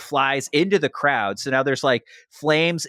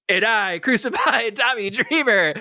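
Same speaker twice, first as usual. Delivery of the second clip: laughing mock villain boast; 9 semitones higher than usual. Raven's smug line after lights return.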